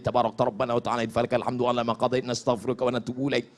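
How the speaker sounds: fast, low, soft Arabic rattle; rapid recitation. Shown as a demonstration. Preacher reciting the remaining praise to Allah, quick low recitation. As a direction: rush through the rest of the prayer under the voice. Races through rest of prayer.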